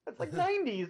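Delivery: disbelieving protest; incredulous. Real, not acted. Protesting the age label.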